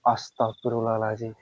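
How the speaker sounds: low, soft muttered astagfirullah; softly muttered plea for protection; muttered prayer. Real, not acted. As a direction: breathe it out under your breath. Muttering prayer under his breath.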